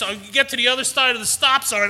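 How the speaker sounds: ordinary delivery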